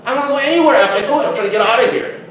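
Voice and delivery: high, loud retold protest; hammed-up insistence on leaving; exasperated refusal. Acted exasperated reply to girl.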